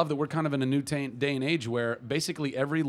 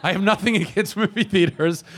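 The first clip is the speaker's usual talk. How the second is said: laughing; 6 semitones higher, 6 dB louder than usual. Real, not acted. Laughing defensive correction.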